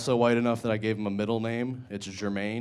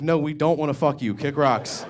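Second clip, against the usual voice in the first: mock yell at kids; 5 semitones higher, 5 dB louder than usual. Yelling at kids.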